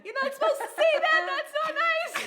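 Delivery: mock scolding, very high and loud through laughter; laughing protest. Wife mock-scolding her nauseous husband, laughing hard. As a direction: squeal a playful telling-off while cracking up.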